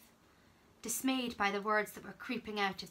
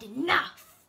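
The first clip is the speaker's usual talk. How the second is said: a mild retelling of the shouted Enough!, louder; retold chilling voice; 4 semitones higher, 7 dB louder than usual. Charlie retelling the frightening voice to Benjamin, mild mock shout. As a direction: echo the scary Enough! without full force. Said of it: "the worst voice I ever heard".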